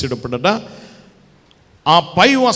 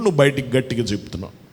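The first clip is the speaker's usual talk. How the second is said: normal talking voice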